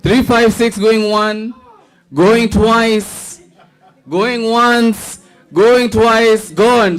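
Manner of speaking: high, bright auctioneer chant; auctioneer call. Pretend. Mock auction call for winner.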